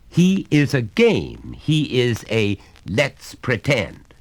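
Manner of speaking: stern father. Acted. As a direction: lay down the law to the boy. Father insists.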